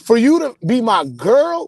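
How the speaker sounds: normal talking voice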